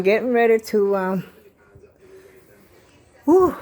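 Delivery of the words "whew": loud winded exhale after a walk; breathless whew. Real, not acted. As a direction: blow out a tired whew. Just back from a tiring walk.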